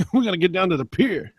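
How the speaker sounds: put-on New York accent. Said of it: "I'm not trying to do a New York accent".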